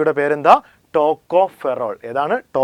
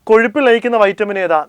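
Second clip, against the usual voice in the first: emphatic question; 7 semitones higher, 5 dB louder, faster than usual. Shown as a demonstration. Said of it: "clear ആണല്ലോ?".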